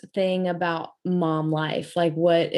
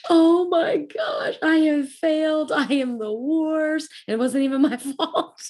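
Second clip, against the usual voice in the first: hammed-up wail breaking into a laugh; mock despair; 9 semitones higher than usual. Mother berating herself, acted.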